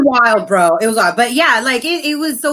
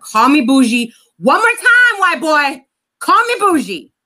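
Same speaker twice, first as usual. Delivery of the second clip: louder, rising challenge as the threat repeats; "Call me bougie" thrown back harder each time; mock angry threat; 5 semitones higher, 4 dB louder than usual. Retold threat, escalating.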